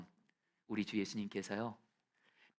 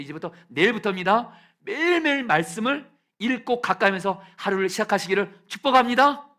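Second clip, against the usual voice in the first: rising blessing; 9 semitones higher, 13 dB louder than usual. Real, not acted. Pastor blessing the church, building to a shout. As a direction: swell into a loud, high blessing.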